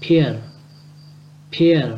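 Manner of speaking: "peer" in one short syllable, p with a puff; quick blended reading. Shown as a demonstration. Teacher shows the word read quickly, blended "peer". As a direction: run the sounds together into a brief "peer". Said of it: "đọc nhanh là".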